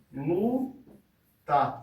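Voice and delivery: "luta" as two slow beats, LU, pause, TA; split into syllables. Shown as a demonstration. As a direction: break luta into separate syllables. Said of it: "a gente vai separar".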